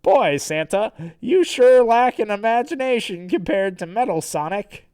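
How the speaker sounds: cartoon hero ribbing the villain; Sonic. Sonic mocks Santa's invention, lightly voiced hero. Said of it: "Sonic is like".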